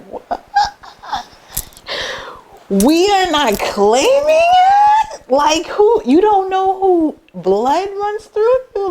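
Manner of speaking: high, slow, stretched outrage; incredulous disbelief. Retold or imitated. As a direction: make it a scandalized question. Incredulous reply to friend hiding heritage.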